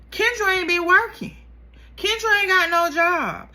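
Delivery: high, loud gossip reveal; dramatic reveal. Real, not acted. Telling viewers the big secret, excited reveal. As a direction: spill it like juicy news.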